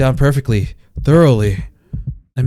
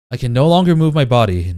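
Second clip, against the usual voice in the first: ordinary delivery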